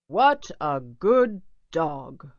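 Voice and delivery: a hint of a pleased father; Papa's praise. A narrator's reading. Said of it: "said Papa Little".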